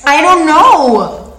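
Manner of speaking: high, loud exasperated cry; loud outburst. Real, not acted. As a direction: blurt it out loud. Defensive outburst, laughing.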